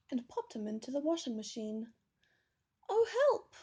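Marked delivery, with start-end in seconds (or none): 2.9-3.5 high, loud squeaky little-bear cry; tiny cry for help. A narrator's reading. Little Teddy trapped in the washing machine, crying for help. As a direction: squeal it out in panic, much higher and louder. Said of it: "cried little Teddy".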